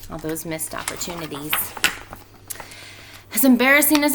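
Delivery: lively storybook reading voice; expressive read-aloud for a class; reading to children. A narrator's reading. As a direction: read it out like story time for kids. Teacher reads picture book aloud.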